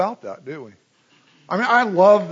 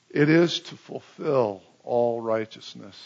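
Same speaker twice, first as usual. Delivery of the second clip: simple and calm; 5 semitones lower than usual. Acted. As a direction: say it simply and gently. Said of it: "And Jesus says simply".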